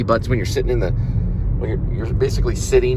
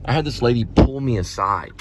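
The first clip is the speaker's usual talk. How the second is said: ordinary talk, nothing marked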